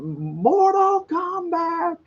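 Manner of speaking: high, long-drawn mock battle cry; imitated holler. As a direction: stretch each word out in a high holler. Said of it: "I did holler out".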